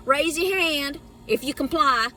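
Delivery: mock Southern preacher. Asking the crowd to comply, mock Southern preacher. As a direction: call for hands like a Southern preacher.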